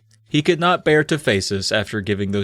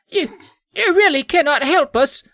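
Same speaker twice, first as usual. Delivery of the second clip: very high, squeaky pleading voice; hammed-up child's desperate plea. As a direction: plead haltingly as a dismayed child. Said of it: "I pleaded".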